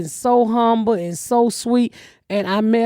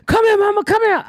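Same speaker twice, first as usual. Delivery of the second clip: high eager call, a bit louder; daughter calling; 13 semitones higher, 4 dB louder than usual. Retold or imitated. Daughter calling her mom over at the awards, high. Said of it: "Ashley come".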